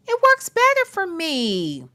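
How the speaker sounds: normal talking voice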